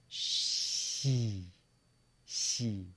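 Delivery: "Xi" as a hissy, smiled shee; big-smile pronunciation. Shown as a demonstration. Teacher turns the shush into a smiled "Xi". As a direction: spread a smile through "Xi". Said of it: "say it with a big smile".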